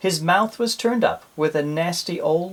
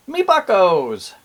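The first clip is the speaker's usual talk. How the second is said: pirate greeting; 4 semitones higher, 4 dB louder, slower than usual. Shown as a demonstration. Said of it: "what pirates call their friends".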